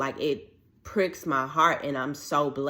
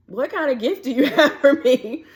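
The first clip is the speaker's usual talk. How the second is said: much higher and louder, laughing through it; incredulous laugh; 11 semitones higher, 9 dB louder than usual. Retold or imitated. Mocking the odd voicemail, laughing and incredulous. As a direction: laugh in disbelief mid-question.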